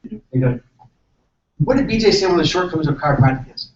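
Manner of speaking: ordinary talk, nothing marked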